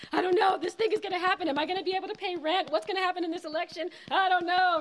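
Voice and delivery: high, rushed panicky worrying; hammed-up racing anxious thoughts; mock anxious spiraling. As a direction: act out a panicking nervous system, fast and high. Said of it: "sympathetic nervous system is being like".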